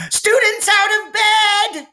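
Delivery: high, loud sing-song bellow of a gleeful poltergeist; Peeves bellowing. A narrator's reading. Poltergeist Peeves betrays the students to Filch, bellowing high and loud. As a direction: belt it out in a high, loud singing cry. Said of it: "Peeves bellowed".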